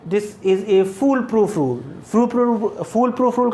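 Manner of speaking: very slow, fool stressed and falling; slow emphatic. Shown as a demonstration. Teacher insisting to students the rule is foolproof, slow. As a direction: drag out "fool proof rule". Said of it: "teacher stresses the rule".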